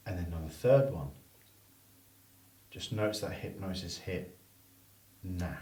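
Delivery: soft induction. Shown as a demonstration. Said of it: "induction demo".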